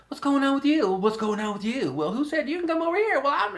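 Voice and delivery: high, squeaky little animal voice; squeaky critter voice. Pretend. Man voicing little animals gathering under a tree, high squeaky chatter. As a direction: play a tiny chattering creature.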